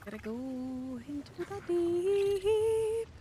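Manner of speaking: slow, high drawn-out intonation; dramatic drawl. Pretend. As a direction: stretch it like a movie trailer. Dramatic dive announcement.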